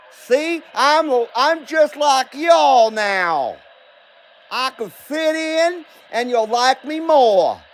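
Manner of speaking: put-on Southern accent, slow and sing-song. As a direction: play a folksy Southern good old boy. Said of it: "I was gonna do my show in an accent".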